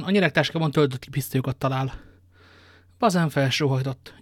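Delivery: ordinary delivery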